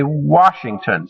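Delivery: WASHINGTON with clear emphasis on the first syllable, WA; first-syllable stress. Shown as a demonstration. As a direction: lean on "Wa" in "Washington", letting "shington" fall away. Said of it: "stress is put on that first syllable".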